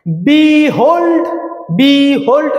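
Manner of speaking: বিহোল্ড divided into বি and হোল্ড; syllable by syllable. Shown as a demonstration. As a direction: give each syllable of বিহোল্ড its own beat. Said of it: "দুটো syllable". (দুই ভাগে আলাদা করে বিহোল্ড)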